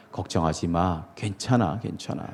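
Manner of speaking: soft, low, gentle reassurance; tender comfort. Retold or imitated. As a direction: soothe him like a friend. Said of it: "예수님은 베드로에게 그렇게 말씀하신다". (예수님이 베드로를 다독이듯 낮고 부드럽게)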